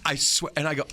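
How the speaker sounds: usual speaking voice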